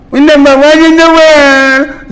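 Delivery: sneering singsong boast; mocking boast. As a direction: crow it in a high mocking voice.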